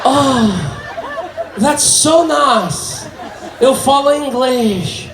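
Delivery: excited boss. Retold or imitated. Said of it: "my boss like".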